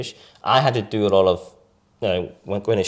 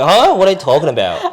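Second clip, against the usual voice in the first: high, loud confused "Huh?" then quick; 7 semitones higher, 6 dB louder than usual. Retold or imitated. Classmates baffled when Pierre says "enemy", confused and loud. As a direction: react baffled and laughing. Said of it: "And they were like".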